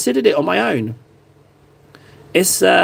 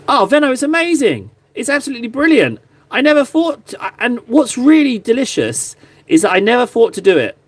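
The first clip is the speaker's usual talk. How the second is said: excited; 7 semitones higher than usual. Real, not acted. Delighted by viewer's suggestion.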